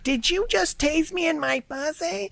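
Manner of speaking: high, slow, incredulous woman's voice; shocked woman. Retold or imitated. Tased woman asking officer in disbelief, high mock voice. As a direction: squeak it out in disbelief. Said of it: "she's like".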